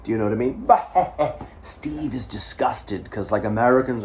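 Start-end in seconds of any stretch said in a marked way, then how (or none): 0.6-1.7 high laugh. Real, not acted. Laughing at mooning story.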